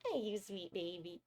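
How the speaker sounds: sweet pet-talk voice. Real, not acted. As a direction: coo at the little pet. Cooing at her pet.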